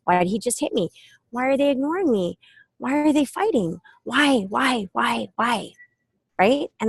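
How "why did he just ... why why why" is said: put-on lightly higher, endless questioning child; small child asking why over and over; whiny kid. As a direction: play a kid pestering with why. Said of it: "That's how kids are".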